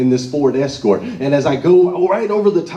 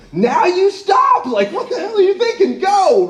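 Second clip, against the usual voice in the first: mock panicked passenger yelling to go; screaming friend. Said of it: "who'd been screaming in the passenger seat".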